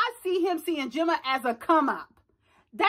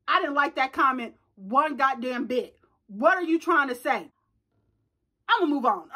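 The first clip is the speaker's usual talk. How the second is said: ordinary talk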